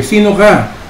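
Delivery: mock call, pitched high; child calling again into the woods. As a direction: shout the question into the forest. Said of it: "At ang sabi niya".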